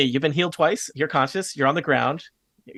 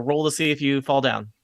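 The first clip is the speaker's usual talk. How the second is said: normal talking voice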